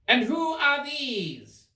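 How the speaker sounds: high, slow queen's demand; haughty queen. Acted. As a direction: lift it high and drag it out.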